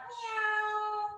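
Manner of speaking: long, high Halloween-cat meow; kitty cat meow. Shown as a demonstration. Teacher rounding the spine like a cat, high meowing. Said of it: "like a Halloween kitty cat".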